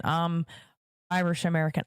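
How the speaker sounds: ordinary delivery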